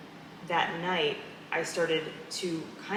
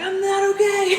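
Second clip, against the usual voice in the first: much higher and louder, laughing through it; laughing mock wail; 10 dB louder, slower than usual. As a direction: wail it as a joke while cracking up. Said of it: "I'm like".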